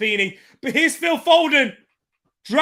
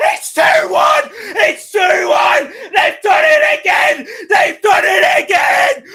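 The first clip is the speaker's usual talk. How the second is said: screaming goal call; excited shout; 10 semitones higher, 8 dB louder than usual. Real, not acted. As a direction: yell it at the top of your lungs. Watch-along goal celebration, shouting.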